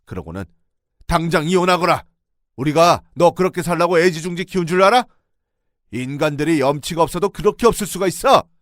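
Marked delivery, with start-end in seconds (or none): 1.1-8.5 loud, high fatherly fury; furious roar. Retold or imitated. Father-in-law roars at his daughter. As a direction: thunder the order. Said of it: "다시 소리를 질렀어요".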